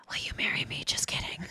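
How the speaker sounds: soft breathy whisper of a secret. Retold or imitated. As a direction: whisper it like a telephone game message. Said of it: "where I would whisper something".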